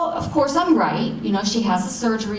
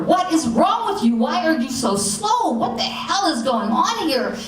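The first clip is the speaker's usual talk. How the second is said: raised, higher, frustrated mock scream; mock yelling at a computer; 6 semitones higher than usual. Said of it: "I'm screaming at this computer".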